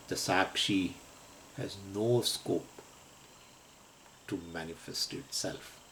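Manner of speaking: ordinary talk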